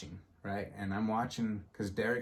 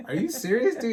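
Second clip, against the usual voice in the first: excited disbelief; 14 semitones higher, 8 dB louder than usual. Retold or imitated. Stranger recognizes him from Twitter.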